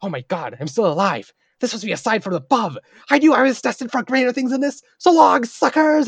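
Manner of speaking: amazed, rising into a high, loud gloating yell; elated goblin. Retold or imitated. Goblin realizes he survived and flees, elated. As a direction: build from breathless disbelief to a high triumphant shout. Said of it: "He was like".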